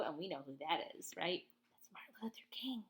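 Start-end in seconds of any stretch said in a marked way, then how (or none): 1.8-2.8 soft excited whisper. Real, not acted. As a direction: whisper it like a secret. Reader reveals the character.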